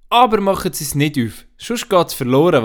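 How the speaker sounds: put-on the child urgently says not to open it; little girl Anna. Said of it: "sagt Anna".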